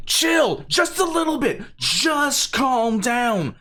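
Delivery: high-pitched, exasperated urging; mock exasperated plea. Pleads with the retold tyrant.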